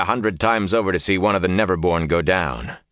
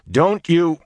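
cut off; 10 semitones higher, 3 dB louder than usual. A narrator's reading. Cut off as Fade leaps.

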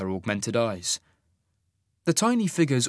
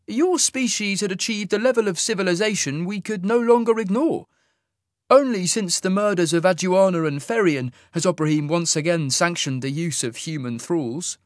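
cool alien officer; 7 semitones higher than usual. Acted. Fade officer to Sasha, a cool higher suggestion.

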